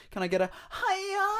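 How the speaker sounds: falsetto sing-song "hiya" stretched up high; high hiya call. Shown as a demonstration. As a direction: call it out like a chirpy greeting. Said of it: "if you hear someone go".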